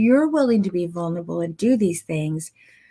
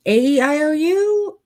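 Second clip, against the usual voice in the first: vowels far higher and louder, rising; mock anxious vowels; high unsure client; 9 semitones higher, 5 dB louder than usual. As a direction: squeak them out like a confused client. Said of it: "or, uh".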